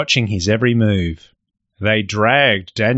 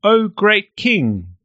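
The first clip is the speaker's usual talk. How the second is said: high, slow fawning "O great king"; bow and flatter the king; fawning courtiers; 10 semitones higher, slower than usual. Acted. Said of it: "They bowed very low and said".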